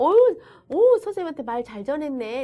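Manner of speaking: high, loud delighted exclamation easing down; acted teacher praising a child; praise. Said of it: "이렇게 칭찬을 받고".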